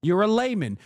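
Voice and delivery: ordinary talk, nothing marked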